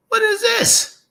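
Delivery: high squeaky mock outrage; mock disbelief. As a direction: shriek it in fake shock. Mocking shrine question, high shout.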